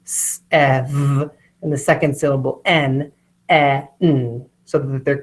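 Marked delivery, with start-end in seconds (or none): 0.0-1.3 "s-ev" sounded out slowly, hissed s, then ev; stretched-out sounds. Shown as a demonstration. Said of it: "stretch out the first syllable". 3.5-4.5 "e-n" as two separate sounds, short eh, then nn; stretched-out sounds. Shown as a demonstration. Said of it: "you stretch out the first syllable".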